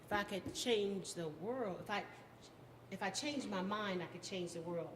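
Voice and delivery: spoken-word poem with swelling rises and falling line ends; poetry recitation. Acted. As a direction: perform it as a rousing poem, with weighted pauses.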